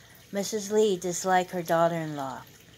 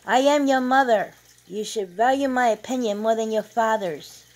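loud, raised-voice maternal scolding; indignant rebuke; 4 semitones higher, 7 dB louder than usual. A narrator's reading. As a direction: assert a mother's authority forcefully. Mother rebukes son in quarrel.

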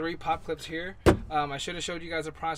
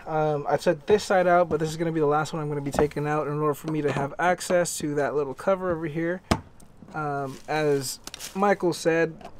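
ordinary delivery

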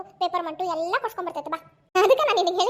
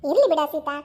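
ordinary talk, nothing marked

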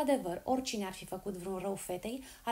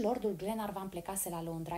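ordinary delivery

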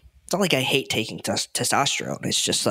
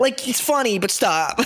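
much higher, playful protest; amused protest; 11 semitones higher than usual. Real, not acted. Reacting to the parody with a friend, high amused protest. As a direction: plead through amusement, pitch shooting up. Laughing protest at the mocking videos.

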